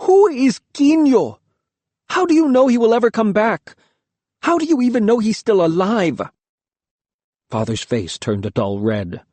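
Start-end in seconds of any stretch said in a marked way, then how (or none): 0.1-6.2 shouting. A narrator's reading. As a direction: yell it in disgust. Boy shouts at father.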